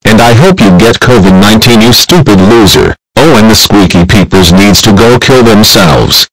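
very loud scolding; yelling back. Acted. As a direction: hurl insults back at top volume. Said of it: "stop yelling at him, Coconut Fred".

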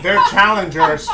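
normal talking voice

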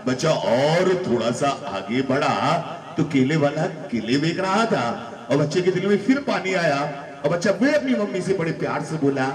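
normal talking voice

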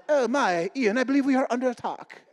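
high, mock-British wartime officer; British airman. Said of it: "The British during the World War Two".

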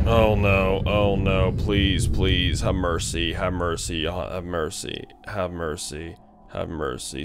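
pleading. Real, not acted. Begging game to spare him.

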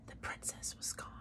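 plain reading voice

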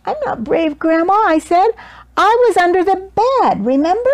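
put-on small child insisting; child's voice. A narrator's reading. Child protests to Grandma.

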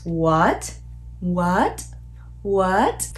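WHAT swept upward like a question; rising tone. Shown as a demonstration. Teacher showing the second tone, rising WHAT. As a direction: pitch climbs through WHAT. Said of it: "The second tone is the rising tone".

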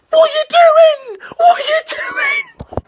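very high, loud, drawn-out wail; exasperated screech. Acted. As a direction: scream it in disbelief at the players. Screaming at the players' blunder.

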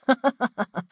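short villainous chuckle; villain's chuckle. Acted. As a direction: chuckle briefly to yourself. Villain character's laugh.

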